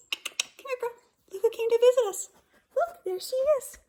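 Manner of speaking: high, loud excited call to the dog. Real, not acted. Teacher calling her dog over to the camera. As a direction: coax the dog over happily.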